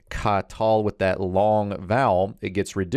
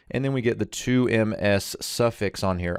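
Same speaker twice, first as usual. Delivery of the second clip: ordinary talk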